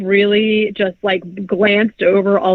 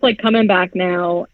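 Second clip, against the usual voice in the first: ordinary talk, nothing marked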